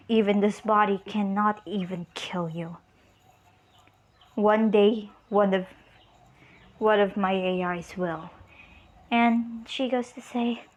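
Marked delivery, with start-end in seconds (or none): 0.1-8.3 low, lightly voiced threat; severed robot head. Destroyed robot head threatens its killer, low menace. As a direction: drop the pitch for a mild menace. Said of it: "head still in count. And it said".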